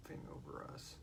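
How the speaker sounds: usual speaking voice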